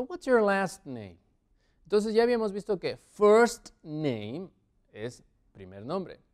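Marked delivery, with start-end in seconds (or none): 0.0-1.2 higher, less drawn out, words linked; slightly slow but connected teacher repeat; connected model question. Shown as a demonstration. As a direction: repeat it as one flowing question. Teacher repeats question more fluently.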